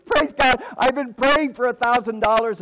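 high, loud shout of praise; acting out an overjoyed wife; jubilant shout. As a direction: shout thanks for an answered prayer. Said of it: "She shouts hallelujah and says".

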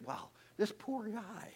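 ordinary talk, nothing marked

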